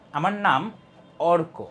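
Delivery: pause, then Arka said with force; stress on the name. Shown as a demonstration. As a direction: set Arka apart and lean on it. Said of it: "এটার উপর কিন্তু আমি বেশি জোর দিয়ে বলছি".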